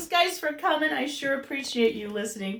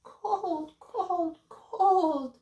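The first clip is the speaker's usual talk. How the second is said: shivering babies; 7 semitones higher, much slower than usual. Acted. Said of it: "said the shivering babies".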